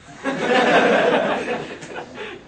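high breathy laughter. Real, not acted. Speaker laughing at his younger self. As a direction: burst out laughing.

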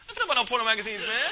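mock-offended refusal; indignant protest. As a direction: protest as if your honor is at stake. Imam objects to the plan.

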